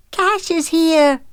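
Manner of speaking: put-on high, loud, drawn-out child's squeal; little girl squealing, stretched out high; excited squeal. A narrator's reading. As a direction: squeal the news with delight. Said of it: "Madison squealed as she ran".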